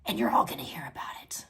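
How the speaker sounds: low unvoiced whisper, fast. Real, not acted. Warning the chat she'll be yelling, in a whisper. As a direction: breathe it as a quick aside.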